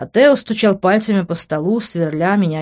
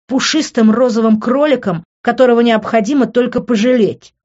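high, emphatic, biting taunt; mocking, raised-pitch question; venomous; 6 semitones higher, 5 dB louder than usual. A narrator's reading. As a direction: needle them with poisonous sarcasm. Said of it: "ядовито спросил Тео".

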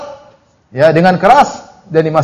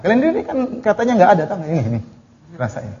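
fast, then much softer sneering "Rasain"; soft gloating mockery; mocking taunt; 9 dB quieter, faster than usual. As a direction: taunt them quietly, gloating. God mocking the damned.

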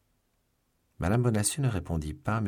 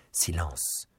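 startled hush; 3 dB quieter than usual. A narrator's reading. Young woman hearing voices in the street, startled hush. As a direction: hush him with a slight start. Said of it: "dit madame Bonacieux en tressaillant".

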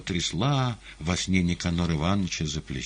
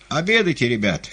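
higher, louder cheerful call; shouting; 8 semitones higher, 6 dB louder than usual. A narrator's reading. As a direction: call out across the hall. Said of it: "кричали повара".

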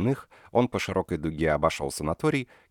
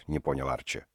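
plain reading voice

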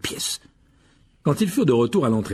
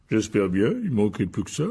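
gruff giant's grumble; growling Hagrid. Acted. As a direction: growl it as the big gamekeeper. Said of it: "grogna Hagrid". (géant qui grogne)

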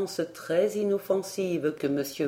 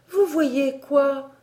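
high, louder and slow astonished question; amazed girl; 8 semitones higher, 5 dB louder, slower than usual. A narrator's reading. Elsie asks her governess in high, slow amazement. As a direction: marvel at what she hears.

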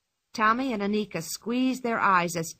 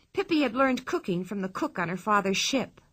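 plain reading voice

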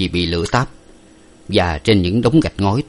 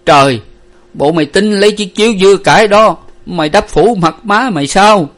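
raised, indignant, high voice; loud objection; 11 semitones higher, 7 dB louder than usual. A narrator's reading. Father stops daughter covering his wife's face, loud objection. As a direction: protest loudly to stop her. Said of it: "Ông Năm to tiếng cản lại".

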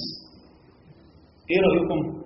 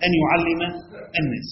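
ordinary talk, nothing marked

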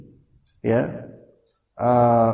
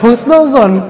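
laughing; 8 semitones higher, 8 dB louder, slower than usual. Real, not acted. Laughing with the audience.

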